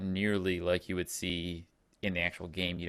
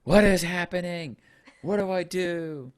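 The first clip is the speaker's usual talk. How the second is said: high, bewildered mock panic; panicked confusion; 11 semitones higher, 7 dB louder than usual. Todd panicking in the haunted house, imitated high and lost. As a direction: play the lost gamer who has no clue, pitched way up. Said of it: "Todd's just like".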